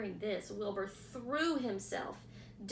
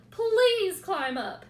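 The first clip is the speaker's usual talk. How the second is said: higher, louder desperate begging; 10 semitones higher, 8 dB louder than usual. A narrator's reading. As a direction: pig fearing his friend's loss. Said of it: "said Wilbur in desperation".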